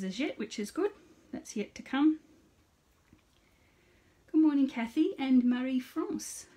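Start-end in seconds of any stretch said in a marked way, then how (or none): 4.3-6.5 put-on greeting in a playful French accent, pitched higher and louder. Host greets viewers Kathy and Marie France in a French accent. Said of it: "put your French accent on".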